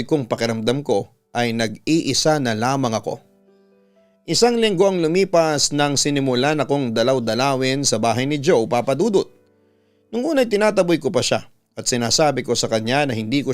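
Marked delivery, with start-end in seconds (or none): none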